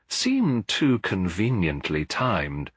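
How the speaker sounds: ordinary delivery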